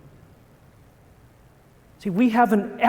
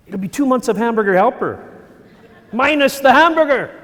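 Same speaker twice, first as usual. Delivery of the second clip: louder and higher as the worry peaks; rising panic; 6 semitones higher, 8 dB louder than usual. Retold or imitated. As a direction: let the panic spike on the punchline. Panicked inner thoughts.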